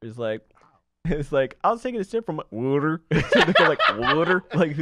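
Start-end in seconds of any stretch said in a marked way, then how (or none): 1.6-3.0 put-on Southern accent, "water" said with a U sound, like "wooder". Imitating Southern friends, "water" said as "wooder". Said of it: "pronounce it with the U". 4.0-4.4 "Water" said with a U, like "wooder"; mock-Southern "Water" with a rounded U vowel. Imitating people from the South, "Water" said as "wooder". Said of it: "the way they pronounce water".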